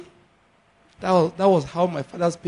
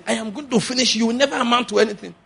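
higher-pitched, quickening colleague's threat; mock office threat; 8 semitones higher, faster than usual. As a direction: play a colleague laying down a threat.

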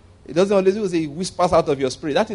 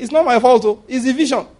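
defensive Peter; 8 semitones higher, 4 dB louder than usual. Acted. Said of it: "He said".

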